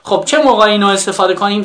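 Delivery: ordinary delivery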